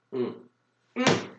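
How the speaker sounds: normal talking voice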